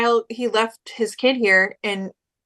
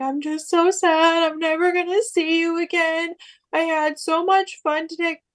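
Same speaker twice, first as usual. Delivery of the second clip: retold sobbing; 8 semitones higher than usual. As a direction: imitate a sad child, pitched well up. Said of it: "he just starts sobbing".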